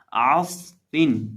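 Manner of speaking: آصفن slow with lip-and-teeth ف; correct pronunciation. Shown as a demonstration. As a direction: stretch آصفن. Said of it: "تو یہ اس کی آواز ہے".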